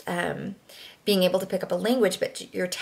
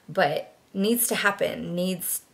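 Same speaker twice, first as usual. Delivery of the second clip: normal talking voice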